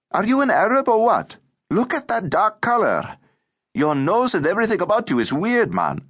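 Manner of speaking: put-on high-pitched, incredulous boy's taunt; brash teasing boy. A narrator's reading. Ramazan questions the new boy about his colour, brash and mocking. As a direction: needle him in a high boy's voice.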